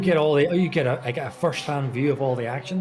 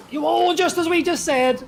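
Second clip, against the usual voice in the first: surprised; 12 semitones higher, 4 dB louder than usual. Real, not acted. Spin predicted, then it happens.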